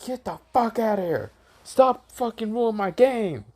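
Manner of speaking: high-pitched acted fury; angry outburst. Card player throws Kakashi out of the bar, furious. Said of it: "This man got so angry".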